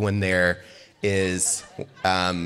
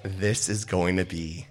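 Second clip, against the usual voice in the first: hushed deadpan; 3 semitones lower, 6 dB quieter, slower than usual. Retold or imitated. Friends watching the eulogy.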